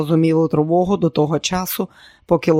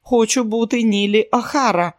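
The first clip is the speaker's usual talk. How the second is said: stubborn insistence; 6 semitones higher than usual. A narrator's reading. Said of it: "уперто стояла на своєму Нілі".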